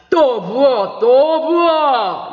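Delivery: put-on loud, stretched-out drunk's call; shouting order. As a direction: bellow for another round. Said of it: "라고 외쳤다".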